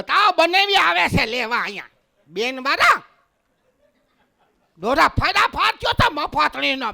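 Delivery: high, loud Saurashtra scolding; hammed-up Kathiyawadi outburst; fearsome Kathiyawadi woman. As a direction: yell at him like a Kathiyawadi woman. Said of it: "ખતરનાકમાં ખતરનાક બાઈ હોય તો સૌરાષ્ટ્ર".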